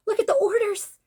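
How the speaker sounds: high excited cry of disbelief; excited squeal. Retold or imitated. Fan thrilled at the flood of orders, high and excited. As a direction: squeal it like you can't believe it.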